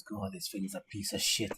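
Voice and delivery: a smith cursing his work to himself; grumbling blacksmith. Acted.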